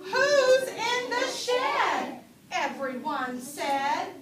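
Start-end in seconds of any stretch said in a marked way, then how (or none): 0.0-2.2 high, loud, slow spooky question; drawn-out, much higher and louder question; curious animals calling. Acted. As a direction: call it out high like the worried farm animals. Animals' question in read-aloud.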